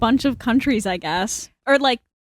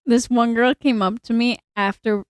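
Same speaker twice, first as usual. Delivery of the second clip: ordinary delivery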